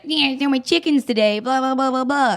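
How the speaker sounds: high-pitched mock prattle; mocking chatterbox imitation. Daughter mimics Donna's phone chatter, high and mocking. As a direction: mimic a tiresome caller, running into high blah-blahs.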